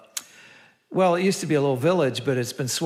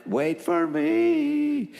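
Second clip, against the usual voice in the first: calling after the bus; 14 semitones higher, much slower than usual. Retold or imitated. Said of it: "I made a run like".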